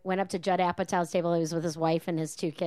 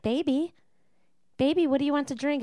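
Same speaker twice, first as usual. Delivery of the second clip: higher, fast coaxing singsong; doting baby talk; 6 semitones higher than usual. Retold or imitated. Older man coaxing his girlfriend over drinks, cooing. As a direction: coo at her like a baby. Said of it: "And he was like".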